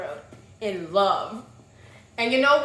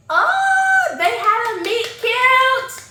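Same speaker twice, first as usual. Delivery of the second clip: high, loud squeal, drawn out; 12 semitones higher, 10 dB louder, much slower than usual. Real, not acted. Fangirling to viewers about the meet cute. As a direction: squeal with delight over the couple. Squealing over romantic scene.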